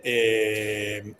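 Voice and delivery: ordinary talk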